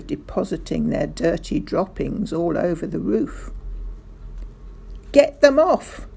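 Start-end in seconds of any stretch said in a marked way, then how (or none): 5.1-6.1 loud, high-pitched angry yell; screamed. A narrator's reading. Mister Hazel sees birds fouling his car, screaming. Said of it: "screamed Mister Hazel".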